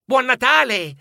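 high, cheerful holiday call; children wishing each other Merry Christmas; children's greeting. A narrator's reading. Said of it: "si auguravano i bambini".

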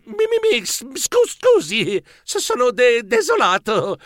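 high-pitched flustered stutter; stammering. A narrator's reading. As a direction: stammer out an embarrassed apology.

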